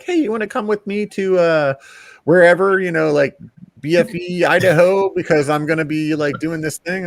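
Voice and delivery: high mock lady voice. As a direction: voice her high and bright. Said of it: "the lady's like".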